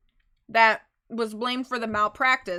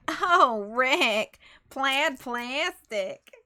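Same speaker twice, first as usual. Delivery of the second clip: plaid plastic voice; 2 semitones higher, 5 dB louder, much slower than usual. Shown as a demonstration. Player auditioning a voice for Von Karma's successor, drawn-out character voice. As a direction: stretch the words out in a put-on voice. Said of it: "should I give him that voice".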